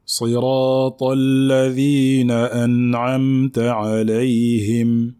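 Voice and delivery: measured chanted tajweed recitation; Quran recitation. Shown as a demonstration. Teacher recites the full verse.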